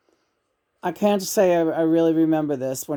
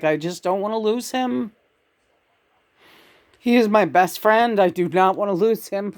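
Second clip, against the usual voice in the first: retold tearful plea; 4 semitones higher than usual. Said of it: "I was crying".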